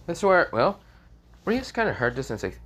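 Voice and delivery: plain reading voice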